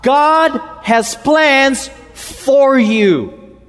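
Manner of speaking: high, drawn-out pastoral shouting; yelling prophetic voice. Acted. Preacher voices Jeremiah warning against false prophets, yelling. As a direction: cry out like a prophet halting the crowd. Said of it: "his loud pastoral yelling prophetic voice".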